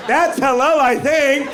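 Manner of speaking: shouted punchline; loud shout. Real, not acted. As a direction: call it out over the laughter. Shouted after laughing fit.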